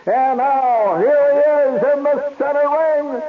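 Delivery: high, slow, booming big-top announcement; circus ringmaster. Retold or imitated. Ringmaster introducing the TV reporter to the crowd, grand announcement. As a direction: stretch every phrase to fill the tent. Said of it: "and he says".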